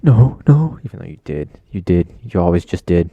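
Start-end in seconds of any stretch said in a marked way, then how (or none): 0.0-0.8 loud, high-pitched protest; mock denial. Kid caught farting in class denies it, mock denial. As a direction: deny it high and loud, hammed-up. Acts caught farter denying it.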